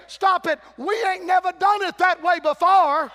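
put-on high, loud, cranky old church member shouting; hammed-up stubborn church elder yelling; dry old Baptist. Old Baptist yelling up at the roofbreakers, hammed-up outrage. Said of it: "some dry old dead Baptist is looking up saying".

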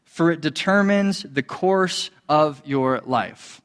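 reading the verse slowly and deliberately; slow scripture reading. Shown as a demonstration. Preacher reading the key verse to the congregation, slow and weighty. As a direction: savour each word of the key verse. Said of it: "it says".

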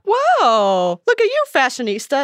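a drawn-out wow at a friend's outfit; playfully impressed, voice lifted. Acted. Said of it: "your friends are gonna be like".

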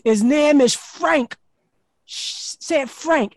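high, loud, slow scolding woman's voice; stern landlord. Retold or imitated. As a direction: drill the child on the name.

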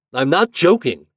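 loud high snap; snapped. A narrator's reading. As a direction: bark it at her. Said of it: "he snapped".

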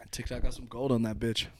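usual speaking voice

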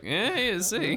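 high, sly "yeah, yeah, see"; knowing eyebrow raise. Acted. Bernie nudging the player with a knowing, high-pitched hint. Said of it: "inclines his eyebrows at you like".

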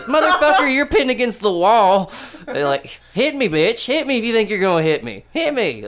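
high, loud taunting dare; aunt pinning him to the wall, mock rage; mock furious dare. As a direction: goad him to hit you. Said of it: "she goes".

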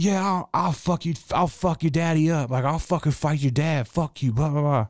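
high-pitched imitated ranting threats; mock screaming. Storyteller imitating a man threatening a kid's dad, mock screaming. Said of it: "Just screaming at 'em. Just like".

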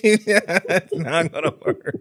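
real laugh running through the words. Real, not acted. Podcaster amused by his own verdict on bragging parents, laughing.

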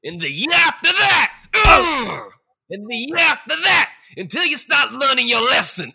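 high furious shout; yelling. Acted. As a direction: roar it while delivering the beating. Spirit beating Scrooge, yelling.

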